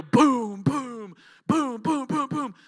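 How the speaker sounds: big echoing sound-effect booms; imitated booming crashes. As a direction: mimic plates crashing one after another. Imitating plates dropping in gym.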